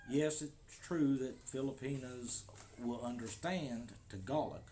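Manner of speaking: ordinary talk, nothing marked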